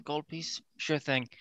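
soft, offhand reply; nonchalant. Acted. Oz pays the bartender for wine, nonchalant. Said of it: "trying to be casual".

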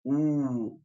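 "U" con labios hacia adelante; kinema de la U. Shown as a demonstration. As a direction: proyecta los labios en "U". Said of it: "que sería así". ("U" con protrusión exagerada)

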